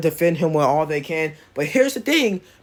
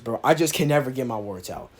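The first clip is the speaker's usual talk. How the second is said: ordinary delivery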